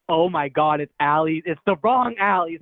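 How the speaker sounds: loud, emphatic dawning horror; retold alarm. Realizing the wrong girl opened the door, alarmed. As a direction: act out a loud, horrified realization.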